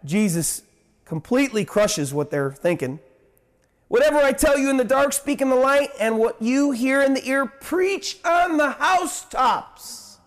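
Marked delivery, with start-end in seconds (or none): 3.9-10.2 preaching. Acted. Preaching scripture at full force.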